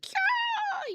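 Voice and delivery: very high, screechy mock caw; hawk caw imitation. Showing viewers what a hawk sounds like, with a high caw. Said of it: "it's like, 'Caw'".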